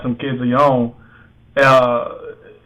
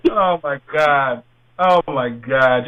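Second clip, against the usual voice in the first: slow disbelief; 2 semitones lower, slower than usual. Real, not acted. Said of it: "repeated slower".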